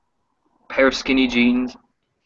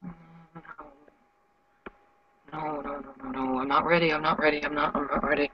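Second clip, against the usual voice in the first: softer, rapid repeated no; frantic soft refusal; 3 semitones higher, 9 dB quieter, faster than usual. Acted. Sasha pulling away from Alex, panicked and soft.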